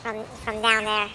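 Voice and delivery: sped-up, squeaky voice changer; chipmunk voice. Real, not acted. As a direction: talk through a chipmunk filter. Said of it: "the middle guy is using the chipmunk voice".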